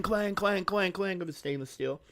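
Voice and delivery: high sing-song clang clang; clanging imitation. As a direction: mimic a metal bowl ringing, high. Imitating cat banging bowl.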